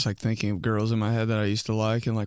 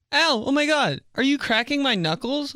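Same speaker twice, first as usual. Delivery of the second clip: put-on girl's high voice; 14 semitones higher than usual. Girl alarmed as he bends her fingers, high mock voice. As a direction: squeal it like a startled girl.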